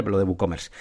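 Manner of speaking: ordinary talk, nothing marked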